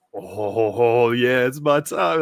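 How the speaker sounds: drawn-out, relishing hype, imitating; slow, hammed-up eager gloating; mock excited. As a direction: play a fighter thrilled his moment has come. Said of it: "He's so excited".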